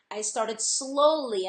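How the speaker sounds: slowly drawn out and leaned on, whole phrase slow; stress on slowly. Real, not acted. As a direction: lean hard on slowly, stretching it. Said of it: "and I emphasize the word slowly".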